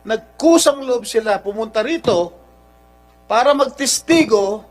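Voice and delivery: loud, heated scolding. Real, not acted. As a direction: lean in and lash out, voice up. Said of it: "I'm sorry for raising my voice".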